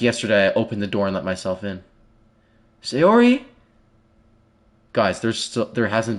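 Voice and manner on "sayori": high, louder call into the house with a rising end; hollering up the stairs for a friend. Acted. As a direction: call her name through the house. Calling friend in empty house.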